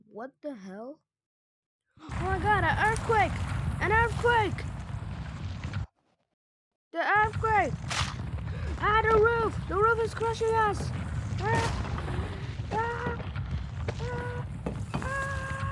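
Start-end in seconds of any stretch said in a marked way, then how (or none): 2.0-10.9 high, loud cries of mock alarm; hammed-up disaster panic; panicked play-acting. Roof collapsing in acted earthquake. 11.4-15.6 series of high mock screams; high-pitched hammed-up "ah" screams; pretend screams. Crushed in pretend earthquake.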